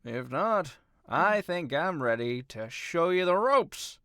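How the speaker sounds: high, loud, eager character voice; rallying mentor, voice lifted and loud; Gigi. Acted. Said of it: "Gigi says".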